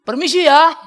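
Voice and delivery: high, blaring polite request; forceful loud call, rising at the end; loud Batak delivery. Shown as a demonstration. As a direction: belt out the courtesy like a Batak. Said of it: "cuma ngomongnya kenceng".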